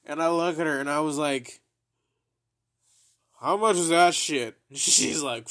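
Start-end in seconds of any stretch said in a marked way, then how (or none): 3.4-4.6 loud question. Retold or imitated. Asking price, still wrecked.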